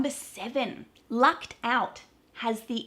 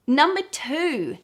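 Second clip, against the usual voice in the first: announcing the number; 6 semitones higher, 6 dB louder, slower than usual. Shown as a demonstration. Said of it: "list heading".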